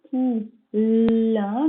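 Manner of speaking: very slow, syllable by syllable, poulain drawn out; slow teacher dictation of poulain. Shown as a demonstration. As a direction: dictate "poulain" slowly to a child, each syllable separated. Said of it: "dictation, word said syllable by syllable".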